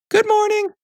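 very high, squeaky-pitched sheep; reply way up high as a sheep; sheep character. A narrator's reading. Ms. Sheep replying to the hen, very high voice.

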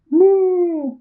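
high, drawn-out moo; storybook cow moo; cow's moo. A narrator's reading. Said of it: "Cow said".

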